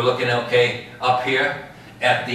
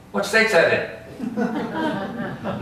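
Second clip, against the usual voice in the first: laughing tease; 10 semitones higher, much slower than usual. Retold or imitated. Tour guide mocking a fan from Albany.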